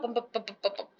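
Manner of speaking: ordinary delivery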